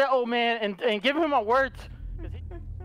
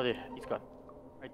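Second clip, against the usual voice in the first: whispering while hiding; 9 semitones lower, 14 dB quieter than usual. Real, not acted. Hiding from monster.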